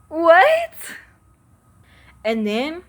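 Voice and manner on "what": loud, high disbelieving what; rising outraged what; stunned what. Real, not acted. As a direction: shout it in shock. Venting, disbelief.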